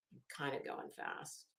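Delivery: breathy self-aware whisper under the breath; whispered aside. Real, not acted. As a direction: drop to a whisper, teasing yourself. Muttered aside to herself.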